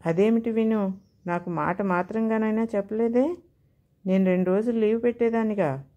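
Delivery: plain reading voice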